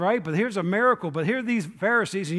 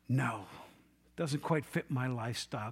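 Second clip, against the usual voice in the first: low, soft brush-off; mock quiet refusal; 6 semitones lower, 8 dB quieter than usual. People turning Jesus down, understated low refusal. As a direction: decline softly and low, as if excusing yourself.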